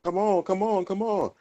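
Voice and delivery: high-pitched, pleading come-ons; retold urging. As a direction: replay urging your teammates on, voice jumping up high. Acting out frantic button-pushing mid-game.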